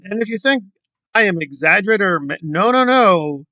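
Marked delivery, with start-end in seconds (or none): none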